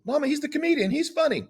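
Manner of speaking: mock son reassuring his mother; imitating her son. Son voiced in retold story.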